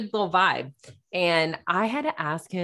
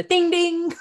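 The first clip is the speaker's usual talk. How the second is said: boxing bell; 14 semitones higher, 5 dB louder than usual. Retold or imitated. Mimics boxing bell between rounds.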